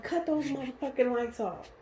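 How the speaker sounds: high-pitched, restrained cop command; retold angry order. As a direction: imitate an irate officer without raising volume. Retold officer ordering lights off.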